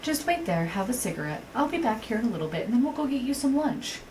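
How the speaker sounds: high, coaxing friend voice; imitated gentle placating. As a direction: mimic a friend soothing someone like a child. Friend gently placates her, retold.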